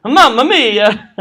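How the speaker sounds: slow, hammed-up Italian Mamma mia; a light Mario-style Italian exclamation; playful Italian accent cry; mock Italian. Joking about Italian Mario.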